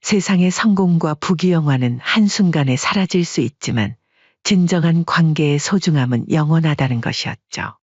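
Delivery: ordinary delivery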